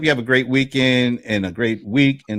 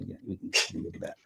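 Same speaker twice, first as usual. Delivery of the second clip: quick whispered aside; 6 semitones lower, 16 dB quieter, faster than usual. Real, not acted. Quick aside after teasing remark.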